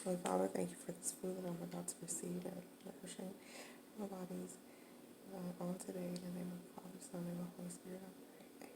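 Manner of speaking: quiet, quick mealtime prayer; saying grace. Real, not acted. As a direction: murmur a fast grace over the food. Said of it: "let's say grace".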